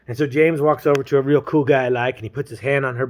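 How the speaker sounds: ordinary talk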